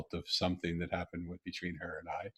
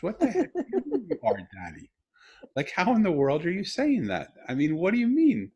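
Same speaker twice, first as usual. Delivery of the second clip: high, raised-voice bafflement; retold incredulity; 9 semitones higher, 6 dB louder than usual. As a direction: demand an explanation in acted-out disbelief, pitch soaring. Retells his astonished reply to daughter.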